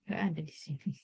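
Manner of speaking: very soft, low mumble; hushed note to self; muttered aside to self. Real, not acted. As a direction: say it quietly to yourself. Still checking, talking to herself.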